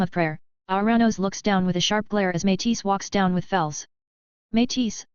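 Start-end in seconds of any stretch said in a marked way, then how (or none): none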